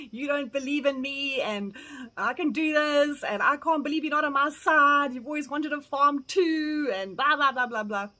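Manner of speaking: imitating a loud, wounded outburst; sulky protest. Arguing with her cousin about the farm, mock sulky outburst. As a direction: whine back like a hurt, stubborn younger self. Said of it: "I sulked for a long time".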